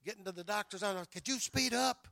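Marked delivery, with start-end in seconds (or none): 1.1-2.1 high, loud urging to hurry; urgent plea. Retold or imitated. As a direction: push the driver to go faster, loudly. Urging driver to hurry to doctor.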